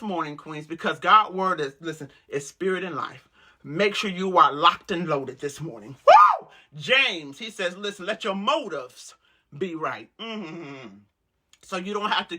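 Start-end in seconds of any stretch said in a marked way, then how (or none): 6.0-6.5 high, loud preacher's whoop; ecstatic woo. Real, not acted. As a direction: whoop it out in fervor.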